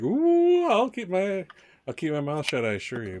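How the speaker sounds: high gushing ooh sliding down into a promise; wide-eyed ooh. Acted. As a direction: start high with wide-eyed delight, then settle. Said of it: "eyes kinda get wide at that, like".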